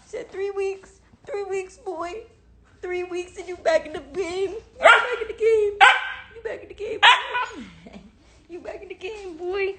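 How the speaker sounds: high, light chant to a pet; high-pitched puppy talk. Real, not acted. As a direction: coo it high and playfully to a puppy. Cooing to the puppy.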